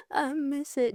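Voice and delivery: a hammed-up tearful whine; mock whimper. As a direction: whimper it like a sulky crybaby. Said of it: "I just will be like".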